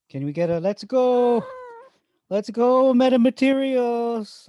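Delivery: high, loud, drawn-out cheer; excited cheering. Real, not acted. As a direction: celebrate like your team scored. Cheering a stock reappearing.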